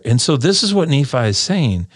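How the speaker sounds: ordinary talk, nothing marked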